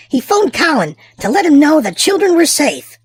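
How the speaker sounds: plain reading voice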